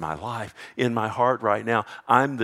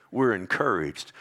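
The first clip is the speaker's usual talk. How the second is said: ordinary talk, nothing marked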